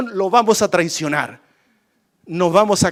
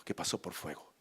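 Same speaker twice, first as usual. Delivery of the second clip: normal talking voice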